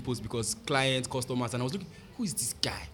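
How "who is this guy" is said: awed whisper. Retold or imitated. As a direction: breathe it out in amazement. Retells being amazed at the photos.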